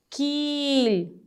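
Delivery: कील very slowly, ee stretched out; soft, long-held ee in कील; long vowel. Shown as a demonstration. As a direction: stretch the ee in कील to double length. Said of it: "बड़ी ई की मात्रा के उच्चारण में लगता है".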